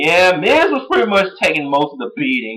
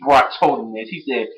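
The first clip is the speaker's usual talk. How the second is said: ordinary talk, nothing marked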